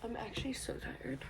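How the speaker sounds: soft, voiceless sleepy confession; tired whisper. Real, not acted. Up at six, admitting tiredness in a whisper. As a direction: sigh it out in a whisper. Sleepy aside early morning.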